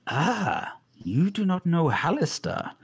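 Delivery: ordinary delivery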